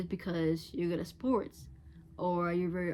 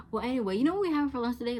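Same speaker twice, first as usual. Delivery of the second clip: quick, higher, louder breezy brush-off; dismissive change of subject; 6 semitones higher, 5 dB louder, much faster than usual. Retold or imitated. Friend brushing off someone's pain, mock breezy. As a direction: rush past their feelings like it's nothing. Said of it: "and they're like".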